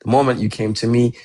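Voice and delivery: ordinary delivery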